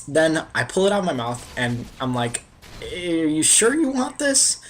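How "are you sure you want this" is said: slow, high, doubtful drawn-out question; incredulous question. Retold or imitated. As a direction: ask it slowly, as if she can't mean it. Offering back the chewed marshmallow.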